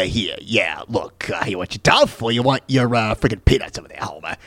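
a hint of a surly bartender; Moe impression. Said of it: "Mo from The Simpsons is kinda like".

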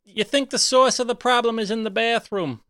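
high-pitched in-character customer; Aunt Susan character voice. Acted. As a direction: put on a high character voice asking for the manager. Plays Aunt Susan in the game.

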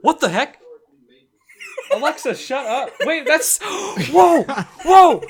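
real startled outburst, high and loud. Real, not acted. Startled host reacts to the Alexa going off, high, loud alarm. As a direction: gasp and yelp in alarm. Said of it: "I was so scared".

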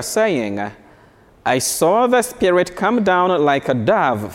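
measured, solemn reading of scripture; formal liturgical reading voice; Gospel reading. Acted. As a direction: proclaim it from the ambo.

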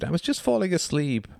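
high, whining woman's complaint; plaintive high-pitched grumble; bleating. A narrator's reading. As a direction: bleat it like someone just woken. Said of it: "she bleated".

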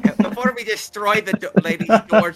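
urgent shout. Acted. Stopping the door from being destroyed.

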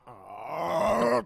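very loud drawn-out "aah"; swelling pretend scream; mock scream. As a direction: let out a long, loud comic yell. Bit of mock screaming after name.

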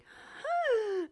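big, high, loud gasp; exaggerated intake of shock; mock gasp. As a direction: gasp as if just proposed to. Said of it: "Yeah, she's like".

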